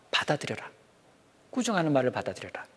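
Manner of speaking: usual speaking voice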